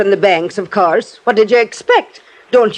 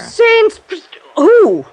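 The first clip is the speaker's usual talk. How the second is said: high frightened exclamation; alarmed; 11 semitones higher than usual. Acted. Landlady to Johnny, alarmed by the attack. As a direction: cry out in fright.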